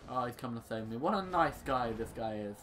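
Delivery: ordinary talk, nothing marked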